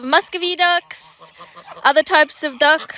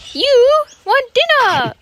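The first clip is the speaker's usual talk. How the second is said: pet talk; 11 semitones higher, 6 dB louder, slower than usual. Real, not acted. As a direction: coo at her like a puppy. Talking to the dog.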